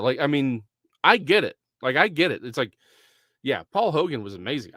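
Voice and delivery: usual speaking voice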